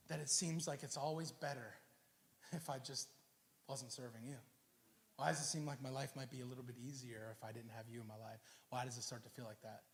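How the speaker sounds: low, very soft questioning; hushed doubt. Acted. Asaph asking God why serving is harder, low and hushed. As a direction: confess the doubt quietly, sinking low.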